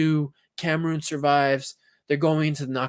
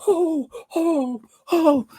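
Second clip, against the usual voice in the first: very high, strained cries of oh; excited cries; 14 semitones higher, 3 dB louder, much slower than usual. Retold or imitated. Watching the goal in class, high excited cries. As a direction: yelp in disbelief at the match. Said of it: "I'm going like".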